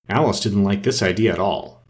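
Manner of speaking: ordinary delivery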